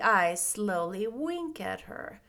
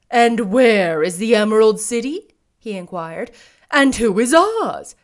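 scarecrow voice; 11 dB louder than usual. A narrator's reading.

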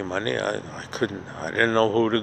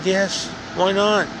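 defiant; 7 semitones higher, slower than usual. Retold or imitated. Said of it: "I said".

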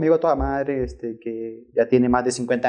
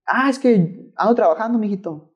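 put-on sweet old woman, fading at mijito; old lady reply; 6 semitones higher than usual. Old lady telling the driver she works, higher granny voice.

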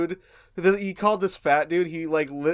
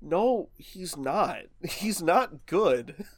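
very slow, softer deadpan denial; firm contradiction; 5 dB quieter, much slower than usual. Retold or imitated. Answering friends who think Biden is fine, slow deadpan. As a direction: draw each word out, gravely amused. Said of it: "And I'm like".

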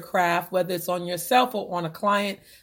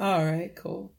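usual speaking voice

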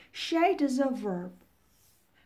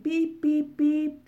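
short, higher beeps on one note; imitated phone beeping; 4 semitones higher, 5 dB louder, slower than usual. Teacher imitates her phone's birthday reminder, beeping. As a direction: mimic a phone reminder's repeated beep. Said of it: "it keeps beeping".

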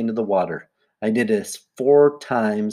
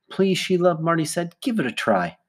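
higher-pitched pleading that settles down; coaxing; 7 semitones higher than usual. Acted. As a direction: gently urge a reluctant kid to try. Marty pleads with reluctant Sheila.

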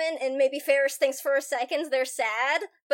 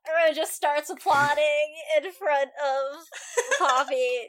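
normal talking voice

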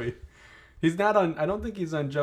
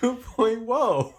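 Joey Lawrence whoa; 9 semitones higher, 5 dB louder, much slower than usual. Pretend. Mimicking a soundboard catchphrase.

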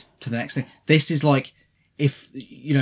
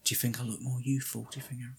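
mock vain whisper; 12 dB quieter, faster than usual. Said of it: "and she's like".

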